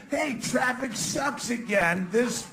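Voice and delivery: normal talking voice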